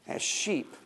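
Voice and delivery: soft breathy aside, "As sheep"; whisper. Acted. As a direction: whisper the word for weight. Emphatic repeat of key word.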